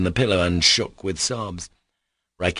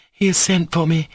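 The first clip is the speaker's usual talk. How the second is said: cry; 10 semitones higher, 5 dB louder than usual. A narrator's reading. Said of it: "she cried".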